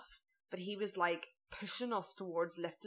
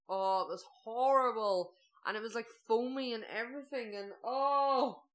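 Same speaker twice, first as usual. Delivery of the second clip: higher, drawn-out groan of disgust; revolted; 4 semitones higher, 5 dB louder than usual. Real, not acted. Diner recalling salty foamy soup, revolted. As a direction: relive the revulsion, stretching the key words.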